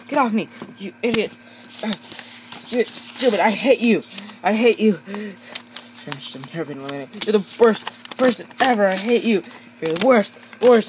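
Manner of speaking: angry yelling. Acted. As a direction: scream at your rival while clinging on. Characters fight on a ledge.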